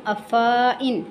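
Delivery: अफ़ाइन with a stretched faa; wrong long vowel. Shown as a demonstration. Teacher warns of a sinful misreading, long faa. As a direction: draw out the middle of अफ़ाइन. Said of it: "अगर ऐसा पढ़ेंगे तो ये गलत".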